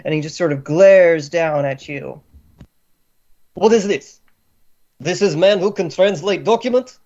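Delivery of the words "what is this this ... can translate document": put-on indignant Slavic-accented grandee. Scowling man glares down at captive, indignant Slavic accent. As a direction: scoff in a thick Slavic accent. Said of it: "That's pretty good Slavic ac-, accent".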